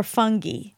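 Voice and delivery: "fungi" with a hard g, ending "ghee"; "fungi" with hard g and final "ee"; fungi as FUNG-ghee. Shown as a demonstration. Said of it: "Do you say fungi or fungi?".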